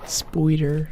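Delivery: spoider with an oi sound; Irish-style spoider. Shown as a demonstration. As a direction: say spoider, oi like in boy. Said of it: "We say spoider".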